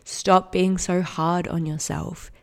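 ordinary talk

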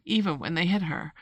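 usual speaking voice

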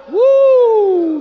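high, loud preacher's whoop; excited woo. Real, not acted. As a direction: let out a joyful shout.

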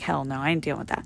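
low, quick muttered refusal; dismissive. Retold or imitated. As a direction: toss it off softly and fast. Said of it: "I'm like".

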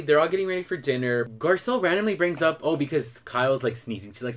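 ordinary talk, nothing marked

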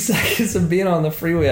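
usual speaking voice

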